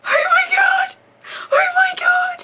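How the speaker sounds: high, loud shriek through laughter; laughing shriek. Real, not acted. Reacting to a friend's cliche, a high laughing shout. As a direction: squeal with helpless laughter. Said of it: "I just laugh my freaking head off".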